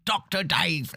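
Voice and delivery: high-pitched mock-Aussie "Doctor Dave"; hammed-up outback Australian twang. Shown as a demonstration. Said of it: "the hick Australian".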